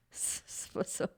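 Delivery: whispered through a laugh. Real, not acted. Catching her own misreading, laughing whisper. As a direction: laugh it off under your breath. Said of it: "Oubliez ça, effacez ça".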